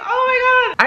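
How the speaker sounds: high squeal of disbelief; overjoyed disbelief. Retold or imitated. Hearing her sugars are normal, high emotional squeal. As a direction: burst out in happy shock. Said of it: "I got so emotional".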